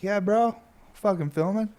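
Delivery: chipper yes. Retold or imitated. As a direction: bounce up into a happy yes. Upbeat reply quoted to friend.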